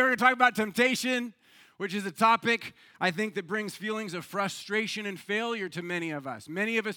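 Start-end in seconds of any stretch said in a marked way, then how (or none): none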